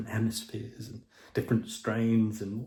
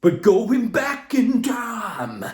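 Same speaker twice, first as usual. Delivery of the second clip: dramatic slow voice; 10 semitones higher, 5 dB louder, much slower than usual. Pretend. Host dramatizes time travel.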